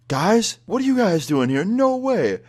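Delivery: high, loud delighted greeting; freaking out happily. Retold or imitated. Tipsy teacher greeting former students, high excited delight. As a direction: drunk teacher thrilled to see old students. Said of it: "he's, like, freaking out".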